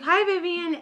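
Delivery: high, bright chirpy greeting from a ghost; spirit's sudden hi. Retold or imitated. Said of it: "he was like".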